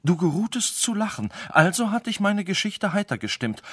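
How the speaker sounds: high, urgent begging; doomed sage desperately pleading with the king; frantic pleading. Acted. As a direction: plead fast before the sword falls. Sage begs king for life.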